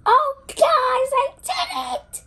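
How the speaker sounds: triumphant yell of success; excited cheer. Real, not acted. Triumph after finishing dig.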